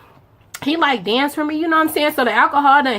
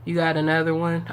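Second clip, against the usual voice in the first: imitating him; 6 semitones lower, 6 dB quieter than usual. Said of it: "You know what he gonna say?".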